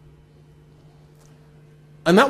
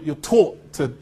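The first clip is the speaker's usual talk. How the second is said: ordinary talk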